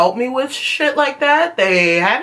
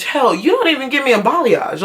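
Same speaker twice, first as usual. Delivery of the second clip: ordinary talk, nothing marked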